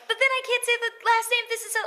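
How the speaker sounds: very high, loud mock whine; high-pitched protest. Actor replaying her protest to the dialect coach, high-pitched. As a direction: squeak out a comic complaint.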